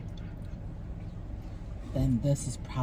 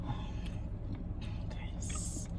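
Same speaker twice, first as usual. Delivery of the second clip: whispering; 13 dB quieter, much slower than usual. Real, not acted. Quietly getting ready to start.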